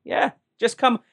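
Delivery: high, hammed-up Irish accent, slow at the end; cheery stage-Irish lilt. Shown as a demonstration. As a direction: pass as a charming Irishman in a bar. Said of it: "my Irish is passable to an American ear".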